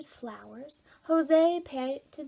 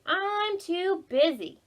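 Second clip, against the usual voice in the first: higher, slow, louder "I'm too busy"; drawn-out brush-off; 8 semitones higher, 6 dB louder, slower than usual. A narrator's reading. Jose to Ruby, a higher, slow, drawn-out brush-off. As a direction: stretch out the excuse. Boys refusing in story.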